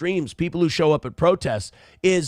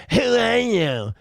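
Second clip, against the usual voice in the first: slow, higher sneering "Who are you?"; mocking quote; 6 semitones higher, slower than usual. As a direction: drag out "Who are you?" in mock disdain. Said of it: "Like you're gatekeeping who can cover".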